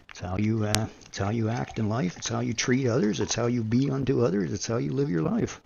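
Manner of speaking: ordinary delivery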